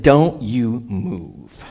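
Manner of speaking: very slow, low command; each word set down slowly and firmly; God's command. Retold or imitated. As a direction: imitate God giving a measured order. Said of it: "Like that".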